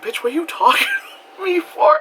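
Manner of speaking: high-pitched, hammed-up indignant question; mock indignation. New Yorker to a chatty stranger, mock indignant. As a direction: act affronted, pitch way up.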